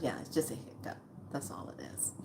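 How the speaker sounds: nurse's words echoed in a whisper; a soft unvoiced repeat of the line. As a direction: hush it back as reassurance. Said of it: "She goes".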